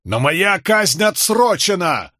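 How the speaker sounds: high, slow desperate wail; wailing cry. A narrator's reading. Condemned man wails at the soldiers dragging him. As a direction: cry out that the execution is postponed. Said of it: "возопил Болейн".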